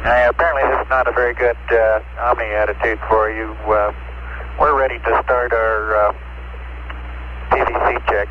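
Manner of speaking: thin, crackling radio transmission; crackly military radio. Real, not acted. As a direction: voice through a distant radio link. Astronaut transmission from spacecraft.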